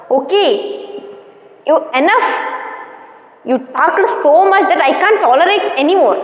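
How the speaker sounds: ordinary delivery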